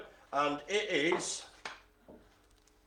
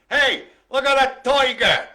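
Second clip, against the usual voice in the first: loud, high New York street shout; New York accent; 11 semitones higher, 10 dB louder than usual. Shown as a demonstration. Host demonstrating tiger for viewers, loud New York accent. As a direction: holler it like a brash New Yorker, toy-guh. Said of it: "tiger in a New York accent".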